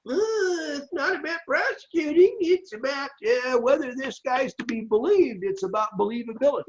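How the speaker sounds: high-pitched mocking imitation of a politician; mock high woman voice. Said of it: "And you know what she did?".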